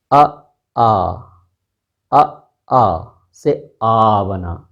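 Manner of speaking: अ and आ stretched very slowly and clearly; drawn-out vowels. Shown as a demonstration. Teacher sounds out the vowels for students, very slowly. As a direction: sound out अ, आ, then the long आ, very slowly. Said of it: "बल्कि द्विस्वर हैं".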